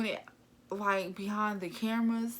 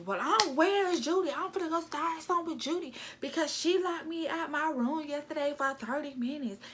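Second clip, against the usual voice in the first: high excited mock voice, petty and dramatic; mocking Cindy; 8 semitones higher than usual. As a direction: ham up her rant about Judy. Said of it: "here's Cindy".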